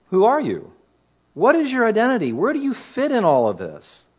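a hint of insistent questioners; priests questioning. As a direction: press with mock questioning, rising high on the key words. Acting priests' questioning of John.

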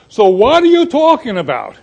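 lightly Italian-flavoured question; a hint of an Italian accent on the question. Shown as a demonstration. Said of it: "my Italian's coming out".

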